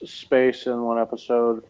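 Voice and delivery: normal talking voice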